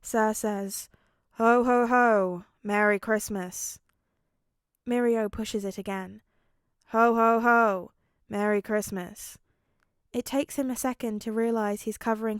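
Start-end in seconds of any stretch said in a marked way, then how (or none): 1.3-3.8 deadpan ho ho ho, loud on the laugh; an unimpressed man forced to play Santa; a recorded tie toy saying ho ho ho in a bored deadpan; monotone, unimpressed Santa. A narrator's reading. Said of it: "In his most monotone, unimpressed voice". 6.9-9.4 deadpan ho ho ho, loud on the laugh; an unimpressed man forced to play Santa; a recorded tie toy saying ho ho ho in a bored deadpan; monotone, unimpressed Santa. A narrator's reading. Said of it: "In his most monotone, unimpressed voice".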